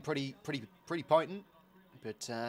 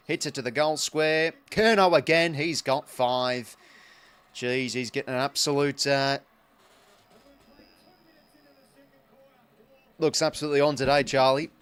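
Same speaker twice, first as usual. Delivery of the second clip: louder and building, he's got five drawn out slow; excited goal call; 3 semitones higher, 10 dB louder, slower than usual. Real, not acted. Streamer calling the fifth goal to viewers, excited and loud. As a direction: roar it as the goal goes in.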